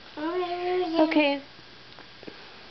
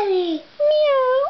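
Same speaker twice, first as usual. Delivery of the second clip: cat meow; 7 semitones higher than usual. Pretend. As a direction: meow sweetly like a kitty. Mom reading animal book to toddler.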